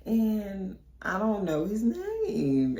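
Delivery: high, then slowed sheepish confession; embarrassed friend. Retold or imitated. Friend confessing she doesn't know his name, sheepish. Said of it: "She was like".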